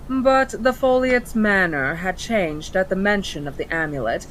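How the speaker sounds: plain reading voice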